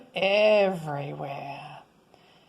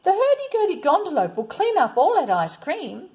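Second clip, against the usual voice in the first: put-on children calling; 8 semitones higher, 5 dB louder than usual. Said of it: "The children called".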